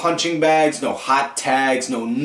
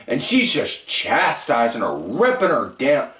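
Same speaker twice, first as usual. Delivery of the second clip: ordinary talk, nothing marked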